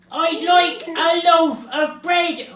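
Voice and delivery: slow, high, very loud roar. A narrator's reading. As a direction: roar it at someone who won't hear. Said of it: "roared Mister Noisy".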